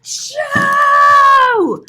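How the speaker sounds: loud, high hammed-up achoo; acted sneeze. Reading aloud, doing Louise's giant sneeze. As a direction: let a huge sneeze fly.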